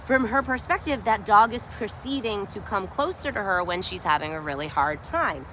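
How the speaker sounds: ordinary talk, nothing marked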